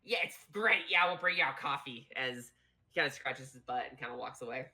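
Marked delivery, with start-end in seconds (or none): 0.2-2.1 a bit louder and higher, brisk goblin server; lightly voiced goblin. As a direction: lightly play an enthusiastic goblin waiter. Goblin server enthuses about coffee.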